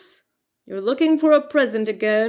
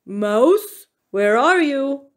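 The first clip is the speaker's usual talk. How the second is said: loud bear voice calling; 4 semitones higher, 6 dB louder, slower than usual. A narrator's reading. Bear searches for missing Mouse, loud call. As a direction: call out through the house as a bear.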